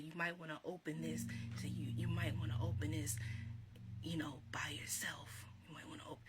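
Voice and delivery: hushed, secretive whisper, imitating grandma; imitated whisper. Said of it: "she whispered in my ear".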